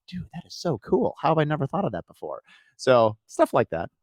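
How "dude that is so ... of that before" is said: higher, hushed amazed gush; mock-amazed delight. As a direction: gush over the cool hat. Excited quote about earmuff hat.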